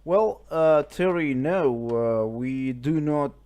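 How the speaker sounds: ordinary talk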